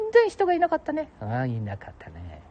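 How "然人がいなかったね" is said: much higher put-on voice; falsetto second voice. Companion recalling the empty town, high falsetto.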